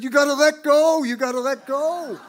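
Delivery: loud, high insistent shout; high and loud, laughing a little at the end; shouted exhortation. Retold or imitated. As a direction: yell it over the crowd like a zealous helper. Another exhorter shouting contrary advice.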